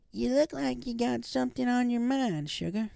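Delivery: put-on a kindly old grandma teasing gently; Granny. A narrator's reading. Granny voiced in story.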